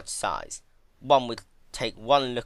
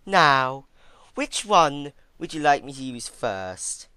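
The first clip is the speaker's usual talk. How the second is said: taunting villain; 6 semitones higher, 9 dB louder, slower than usual. A narrator's reading. Twilight taunting captive princess.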